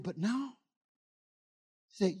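very soft, measured retold instruction; hushed voice of God. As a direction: speak God's words in a hush. Voices God's quiet instruction.

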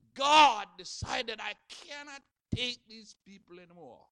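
preaching emphasis. Acted. Preaching peak on God.

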